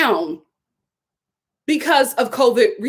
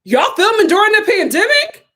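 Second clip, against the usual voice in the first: louder, higher disbelieving call-out; mock outraged; 7 semitones higher, 5 dB louder than usual. Vlogger calling out the cast for filming in a pandemic, loud and incredulous. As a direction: challenge the show in disbelief. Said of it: "I'm like".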